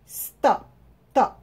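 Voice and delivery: "Stop", then "top" isolated and punched; strong final syllable. Shown as a demonstration. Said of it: "A sílaba mais forte ao final". ("Stop", depois só "top", com força)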